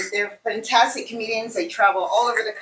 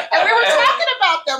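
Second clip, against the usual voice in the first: laughing; 11 semitones higher, 4 dB louder, much faster than usual. Real, not acted. Laughing after joke.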